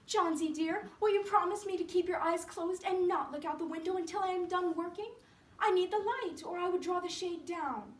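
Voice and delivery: high, urgent plea; pleading Sue. Acted. Sue asking Johnsy to close her eyes, pleading and high.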